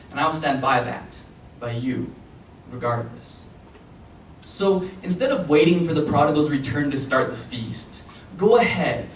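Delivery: measured, earnest slam-poetry delivery; spoken-word poem. Acted. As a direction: recite it to your mother, weighting key phrases. Performs a poem to audience.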